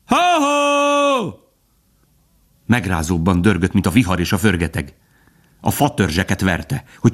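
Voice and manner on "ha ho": very high, long-held holler; hunters' cry. A narrator's reading. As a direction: call out high, stretching each cry. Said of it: "harmincszorosan kiáltott fel". (magas, elnyújtott hajtókiáltás)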